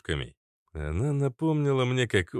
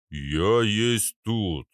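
very slow, measured delivery; ponderous giant; much slower than usual. Acted. Arnold presents himself as heavy weaponry, ponderous. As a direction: stretch every word heavily.

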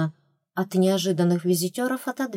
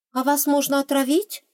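high, interested question with a quaver; curious; 8 semitones higher than usual. A narrator's reading. Heroine suddenly curious about poisoning them, intrigued question. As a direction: let curiosity slip through. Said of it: "заинтересованность проскользнула в дрогнувшем голосе".

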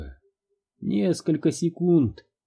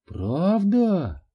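eager; 7 semitones higher than usual. A narrator's reading. Excited child asks.

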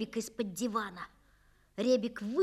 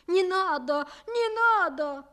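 much higher, louder begging; frightened pleading; 7 semitones higher, 5 dB louder than usual. Acted. Maid begging the master to stop, frightened. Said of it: "говорит Фроська".

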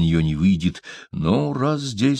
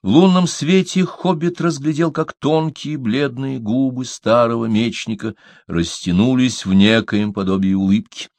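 ordinary delivery

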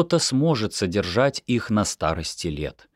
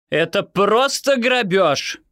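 higher and slow, leaning on «просто»; outraged mother; 12 semitones higher, 4 dB louder, slower than usual. A narrator's reading. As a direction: protest the price, outraged. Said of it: "возмутилась мама". (возмущённо, медленно, с нажимом на «просто»)

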